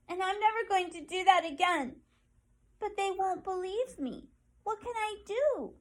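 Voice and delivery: Penny asking for help, hurt and hopeful; pleading porcupine. Acted. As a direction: plead your loneliness like a small creature.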